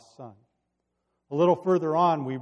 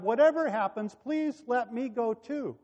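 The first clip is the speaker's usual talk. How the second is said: higher, earnest plea; begging in a raised pitch; pleading; 9 semitones higher, 3 dB louder than usual. A narrator's reading. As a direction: plead to be allowed along. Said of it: "continued to plead with Joab".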